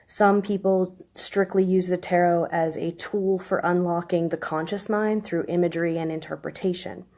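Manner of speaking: ordinary talk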